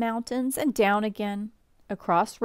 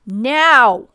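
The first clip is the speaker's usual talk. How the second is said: loud, drawn-out shout; shouted command; 4 semitones higher, 12 dB louder than usual. A narrator's reading. Mama signalling children to throw pepper, shouted command. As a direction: give the signal at full voice, pitched up. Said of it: "shouted Mama".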